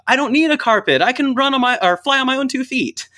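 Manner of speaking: high, bright mock retort shouted across the road; retold yell. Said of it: "I yelled".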